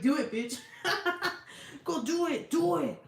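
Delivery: egging Bev on, cackling; gleeful egging on. Retold or imitated. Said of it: "We're like".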